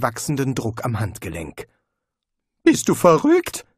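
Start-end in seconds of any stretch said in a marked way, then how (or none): 2.6-3.7 high, loud panicked squeak; frantic alarmed question; panicked hiss. A narrator's reading. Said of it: "flüsterte er".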